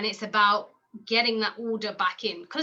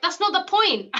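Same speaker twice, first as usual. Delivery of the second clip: much higher, louder exasperated retort; 9 semitones higher, 5 dB louder than usual. Retold or imitated. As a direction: throw it back at her, fed up. Retorting to mum, retold.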